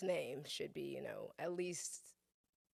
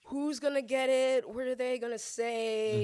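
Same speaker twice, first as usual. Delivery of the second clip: louder, higher, eager mock suspense; eager anticipation; 7 semitones higher, 8 dB louder than usual. Guest acting out waiting for the teacher's roll call, eager. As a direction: wait excitedly for the mistake. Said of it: "I'm just like".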